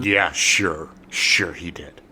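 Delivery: sarcastic. Real, not acted. Doubting Colson's conversion.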